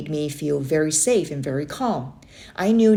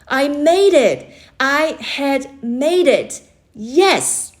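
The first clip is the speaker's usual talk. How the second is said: high, loud joyful shouts; triumphant cheer; 8 semitones higher, 6 dB louder, much slower than usual. Acted. Boy cheers on reaching his uncle's shore, triumphant cheer. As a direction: celebrate the win.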